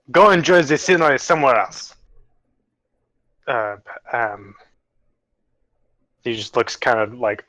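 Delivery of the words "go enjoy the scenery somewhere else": higher-pitched bad German accent; botched German accent. Game master's character dismissing the mushroom, botched German accent. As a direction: send them off in a muddled German accent. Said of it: "It was supposed to be German".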